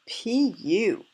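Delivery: hammed-up "yuck" reaction; gagging. A narrator's reading. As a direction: exclaim it like a stink just hit you. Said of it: "Baby Bear gagged".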